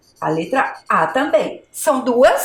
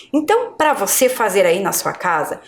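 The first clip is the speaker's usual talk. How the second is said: ordinary delivery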